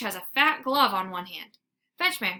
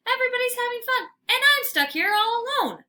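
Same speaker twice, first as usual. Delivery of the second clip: high, fast, loud self-pity; whiny complaint; 13 semitones higher, 6 dB louder than usual. A narrator's reading. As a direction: wail about being left out. Dog complains, trapped alone.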